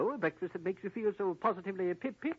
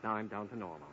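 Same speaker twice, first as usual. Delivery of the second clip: English accent sliding step by step into a much lower voice; voice change; 7 semitones lower, 5 dB quieter than usual. Shown as a demonstration. Agent showing the captive girl his voice change, dropping lower. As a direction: let the fake voice sink down to the real one. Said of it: "now listen to my voice change".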